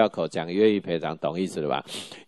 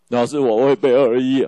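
mock crying; 4 semitones higher, 6 dB louder than usual. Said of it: "老师就哭了".

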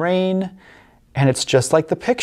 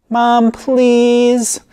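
put-on high, louder little boy begging; pleading child; 9 semitones higher, 5 dB louder than usual. Little boy pleading with mom, whiny child voice. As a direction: beg mom like a small kid. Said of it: "pleads the little boy".

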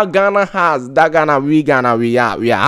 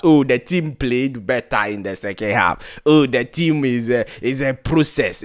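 mocking excuse; 4 semitones lower, 7 dB quieter than usual. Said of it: "We will always say that".

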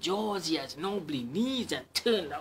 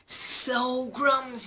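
higher, strange, snaky voice; a scared snake backing off; 10 semitones higher, 5 dB louder than usual. Acted. Snake sees the Gruffalo, high odd snake voice.

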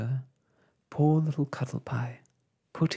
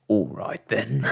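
lower, grudging snap; snapped; 4 semitones lower than usual. A narrator's reading. The patient gives in to Ragged Blossom, snapping. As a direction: give in with a touch of irritation. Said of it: "snapped the patient".

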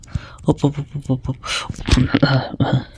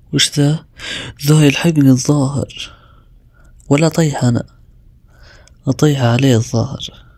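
normal talking voice

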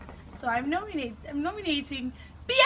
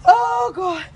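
loud gasp; 5 semitones higher, 10 dB louder than usual. Real, not acted. Reacting to ice cold water.

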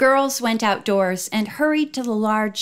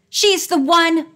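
higher, louder, slower exclamation; exclaiming; 7 semitones higher, 5 dB louder than usual. A narrator's reading. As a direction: point her out, urgent and loud. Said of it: "George exclaimed".

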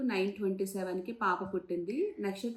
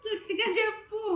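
high-pitched call to speak up; insistent urging; 13 semitones higher, 4 dB louder than usual. Real, not acted. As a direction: urge them on in a much higher voice.

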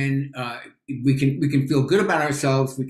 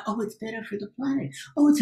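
mocking sheep voice; 8 semitones higher, faster than usual. Guest mocking fake meat fans repeating the slogan, fast and silly. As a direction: parrot the crowd like a dim believer.

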